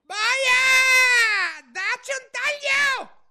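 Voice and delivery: very high, loud royal shriek; furious scream. A narrator's reading. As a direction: scream the execution order, drawn out. Queen orders executions, screaming. (urlo furibondo)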